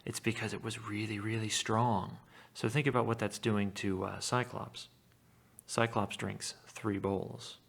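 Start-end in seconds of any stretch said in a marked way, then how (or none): none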